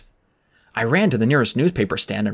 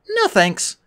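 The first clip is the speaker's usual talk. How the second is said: put-on firm girl refusal; 11 semitones higher than usual. A narrator's reading. Annabeth refuses firmly.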